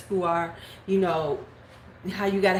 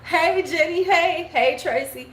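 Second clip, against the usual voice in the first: fake cheerful greeting; 8 semitones higher, 4 dB louder than usual. Fake greetings to imagined girls.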